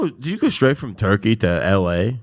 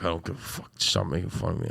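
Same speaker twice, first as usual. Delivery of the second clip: low, much softer retort; playing it off; 3 semitones lower, 10 dB quieter, faster than usual. Host retelling how he answers teasing, playing it off quietly. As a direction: toss it off under the breath. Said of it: "play it off like it's not funny".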